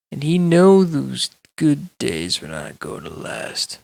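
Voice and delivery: ordinary delivery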